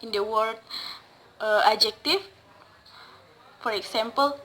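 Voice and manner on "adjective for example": ordinary delivery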